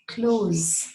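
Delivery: "close" ending in a buzzing Z, not S. Shown as a demonstration. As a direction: say "close" with a voiced Z at the end. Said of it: "with a Z sound".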